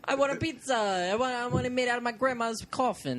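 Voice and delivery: high, loud hammed-up Italian customer; mock Italian accent. Customer orders grandma coffin pizza in hammed-up Italian accent. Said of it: "the Italian accent".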